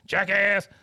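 sudden high, loud insult; shouted heckle. Retold or imitated. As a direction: mimic a furious heckler. Repeats the heckler's interruption.

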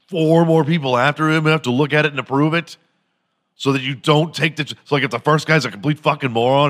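ordinary talk

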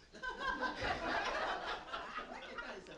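a high, breathy burst of laughter. Real, not acted. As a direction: laugh out the relief. Laughing at the good news.

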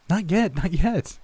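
high, slow sing-song teasing. A narrator's reading. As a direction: lilt it up and down like a taunt. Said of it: "in a sing-song voice".

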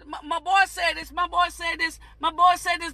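ordinary delivery

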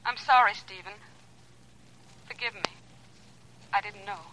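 small, halting apology fading away; hurt apology. Acted. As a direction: withdraw quietly, wounded and embarrassed. Barbara hurt, withdrawing from the call.

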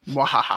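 mock villain cackle; spoken mwahahaha, hammed-up; a villain laughing at his own plan; evil laugh. Villain laugh after gloat.

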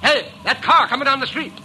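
alarmed shout. Acted. Warning of oncoming car.

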